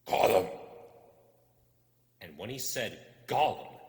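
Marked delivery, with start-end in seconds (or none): 0.1-0.5 loud gulping throat sound; throat swallow noise. Acted. Gollum making his namesake gulp, loud and horrible. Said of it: "horrible swallowing noise in his throat". 3.3-3.8 loud gulping throat sound; throat swallow noise. Acted. Gollum making his namesake gulp, loud and horrible. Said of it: "horrible swallowing noise in his throat".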